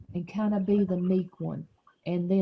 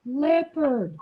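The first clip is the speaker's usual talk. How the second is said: very slow, higher leopard voice; meek leopard voice; 5 semitones higher, much slower than usual. Shown as a demonstration. Teacher leading children reading as meek leopard, drawn out. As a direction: draw out each word for others to join. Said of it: "using your leopard voice".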